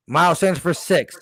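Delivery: ordinary talk, nothing marked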